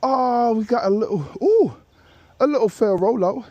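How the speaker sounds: high, rising surprised "ooh"; excited reaction. Real, not acted. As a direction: react eagerly as the ball rolls. Reacting to his drive landing.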